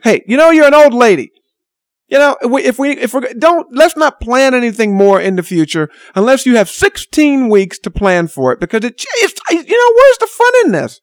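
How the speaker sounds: put-on imagined rant at an old friend; exasperated rant. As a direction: vent at her in mock frustration.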